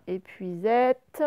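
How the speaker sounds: Épuisette very slow, each syllable clear; slow teaching Épuisette; slow sound demo. Shown as a demonstration. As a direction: spell Épuisette out slowly by ear. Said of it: "le son est, il est à la fin".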